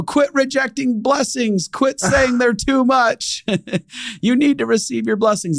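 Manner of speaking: high, slow, laughing mock sermon; playful scolding. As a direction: lecture her with a grin. Playfully lectures the cashier.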